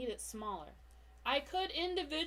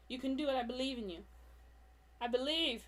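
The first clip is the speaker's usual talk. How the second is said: normal talking voice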